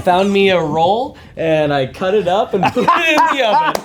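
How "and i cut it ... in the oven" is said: high chuckling confession; laughing through words. Real, not acted. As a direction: laugh it out sheepishly. Confessing store-bought cookie dough.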